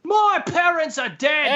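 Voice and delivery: high, slow angry shout; angry shout at Ron; furious shout. Acted. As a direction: yell it at him in fury. Harry shouting in quarrel.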